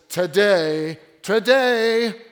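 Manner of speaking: loud, ringing declaration of today; proclaiming. Acted. Jesus to the synagogue crowd, loud proclaiming. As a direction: announce it to the whole synagogue.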